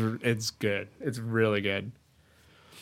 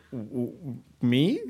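stammering, timid mock surprise; dorky kid; 3 semitones higher, much slower than usual. Host acts a dorky kid being propositioned, stammering disbelief. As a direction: play a flustered dork, pitched up at the end. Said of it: "But the dorky kid's like".

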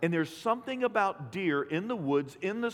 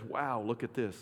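much softer and lower, breathy wonder; hushed awe; 7 semitones lower, 5 dB quieter than usual. Retold or imitated. Congregation hearing what awe says, softly and lower in wonder. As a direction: breathe it out in reverent amazement.

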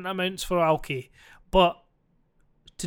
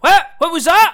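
much higher, louder challenge; confrontational shout; 10 semitones higher, 10 dB louder than usual. Acted. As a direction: square up to the heckler. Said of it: "quite a good wee skit".

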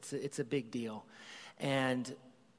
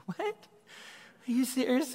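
much higher and louder, amazed; delighted shock; 11 semitones higher, 4 dB louder than usual. Retold or imitated. Dad reacting to his daughter's gift, amazed and high. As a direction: squeal it in happy disbelief. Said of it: "And I'm like".

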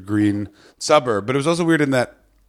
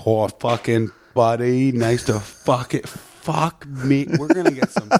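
slow, stretched Southern Ontario jock voice; Southern Ontario jock accent; 2 semitones higher, slower than usual. Shown as a demonstration. Comic imitating Ontario jocks for the host, Southern Ontario jock accent. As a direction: drawl it like a Southern Ontario jock, pitch climbing. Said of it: "the Southern Ontario kinda jockey".

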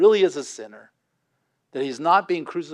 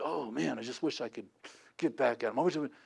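muttered grudge; 3 semitones lower, 12 dB quieter, faster than usual. Retold or imitated. Imitating a resentful victim.

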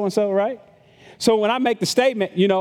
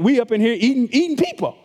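mock outsider. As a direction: play the scandalized outsider. Outsiders imagine cannibal Christians.

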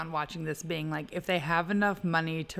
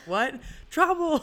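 higher on a punchy last word; mock film quote; 9 semitones higher, 4 dB louder, slower than usual. Co-host replays a favorite film line for friends, mock quote. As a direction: pitch it up, playing out the exchange. Acting out the film's exchange.

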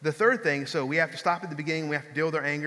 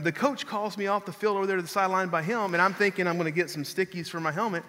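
normal talking voice

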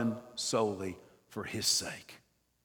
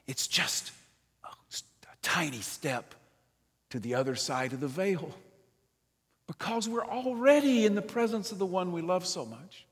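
hushed whisper; 6 semitones higher than usual. Real, not acted. Preacher hushes on death.

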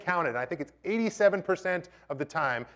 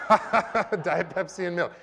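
laughing; 5 semitones higher, 3 dB louder, slower than usual. Real, not acted. Laughing repeat of the recipe.

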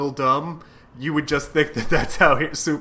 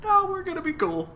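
much higher, softer, goofy cheer; villain insisting on friendship, squeaky and sweet; silly high Razorback; 4 dB quieter than usual. Retold or imitated. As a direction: chirp it like a happy oaf. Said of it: "And he's like".

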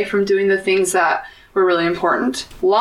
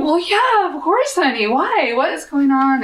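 concerned mom; 8 semitones higher than usual. Retold or imitated. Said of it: "And she's just going".

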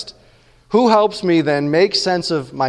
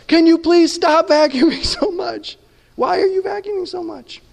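much higher mock pleading voice with a laugh; exasperated roommate Jesus; 11 semitones higher than usual. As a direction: ham up Jesus begging you to stop vacuuming. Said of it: "Jesus is like".

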